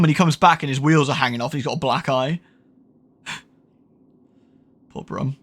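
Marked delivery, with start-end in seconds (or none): 3.3-5.4 soft sighing pity; sighing over poor Brum; mock pity. Real, not acted. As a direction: breathe it out, quiet and sorry for him.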